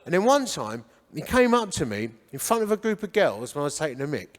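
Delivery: ordinary talk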